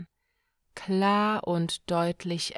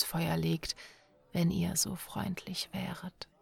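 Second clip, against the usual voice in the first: soft whispered storyteller aside; whispering; 4 dB quieter than usual. Acted. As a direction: confide to listeners in a whisper. Narrator whispers to listeners.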